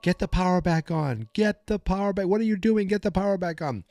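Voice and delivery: frantic high-pitched mock orders; a guard panicking on the walkie-talkie, yelling. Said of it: "he's yelling into it".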